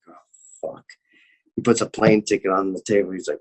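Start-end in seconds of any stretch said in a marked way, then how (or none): 0.1-0.8 very soft, low 'oh no' under the breath; retold sinking dread, almost voiceless; dismayed mutter. Shamed as diners stare during yelling.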